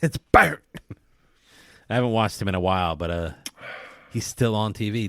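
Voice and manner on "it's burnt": short, high, loud chef shout; mock-disgusted kitchen outburst; Gordon Ramsay. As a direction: pretend to be appalled by the dish. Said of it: "Gordon Ramsay would just be like".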